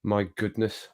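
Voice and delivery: ordinary delivery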